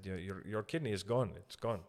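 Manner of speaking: put-on light Indian accent, blunt and matter-of-fact; Indian doctor accent. Doctor telling the family the kidney is gone, in a light Indian accent. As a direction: imitate the Indian doctor's flat delivery, lightly. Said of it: "uh, Indian doctor".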